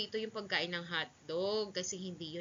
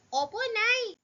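put-on high, loud childlike reply; young boy; 13 semitones higher, 7 dB louder, slower than usual. Son answering mother about studying, childlike. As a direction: answer brightly as an obedient boy, pitched high.

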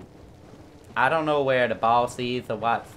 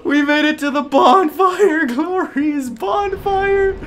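very high, loud squealing joy with laughter; ecstatic shouting; 10 dB louder, much slower than usual. Real, not acted. Gamer finally reaching a bonfire, ecstatic. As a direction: scream with overjoyed relief, near tears.

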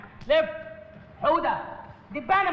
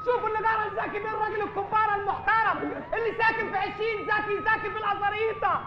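mock public outcry; 9 semitones higher than usual. Doorman shaming his boss to the street, high mock wail.

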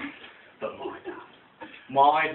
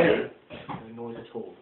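ordinary talk, nothing marked

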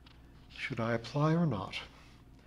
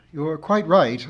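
louder, lifted, magnanimous agreement; noble concession; 5 semitones higher, 10 dB louder, slower than usual. A narrator's reading. Narrator concedes to the old harridan, noble. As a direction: nobly give in to the harridan. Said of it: "I said nobly to the old harridan".

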